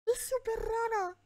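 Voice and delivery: hablar riendo, muy agudo; voz aguda ahogada por la risa; muerta de risa. Real, not acted. Cracks up over the strange voice. (partiéndose de risa)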